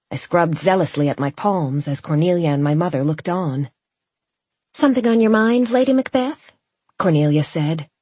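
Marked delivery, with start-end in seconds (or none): 4.7-6.5 high, lilting tease; teasing question. A narrator's reading. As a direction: ask it archly, voice kept up high. Cornelia teases the anxious bride.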